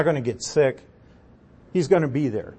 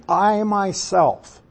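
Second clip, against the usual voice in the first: "I myself" drawn out slowly, pitched higher; emphatic "I myself"; 5 semitones higher, much slower than usual. Shown as a demonstration. Teacher paraphrasing God's emphatic pronoun, stress on "I myself". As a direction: stretch both "I" and "myself" for emphasis. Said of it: "You see the emphatic?".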